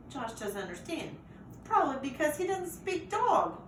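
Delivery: ordinary delivery, nothing marked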